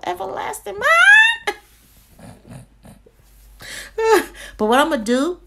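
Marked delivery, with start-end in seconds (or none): 0.0-1.6 high playful shout; loud exclamation. Real, not acted. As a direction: yell it in mock disbelief. Scolding herself over cart.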